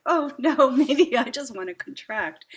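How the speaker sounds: loud, high mock alarm with a laugh; half-laughing, hammed-up dismay at the crowd; mock panic. Caught in the crowd, laughing mock panic. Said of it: "I was like".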